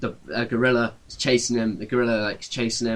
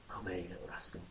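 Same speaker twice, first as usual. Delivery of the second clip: much softer, hushed lure; soft coaxing; 5 semitones lower, 22 dB quieter than usual. Acted. Man creeps up on the caged gorilla and coaxes it softly. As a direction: coax the gorilla close in a hush.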